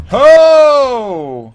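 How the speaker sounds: high, loud startled shout; shocked cry. Real, not acted. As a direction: yelp in sudden alarm. Sudden death in hardcore game.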